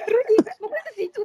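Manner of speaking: high-pitched cry. Retold or imitated. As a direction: cry out in disgust. Accusing friend over smell.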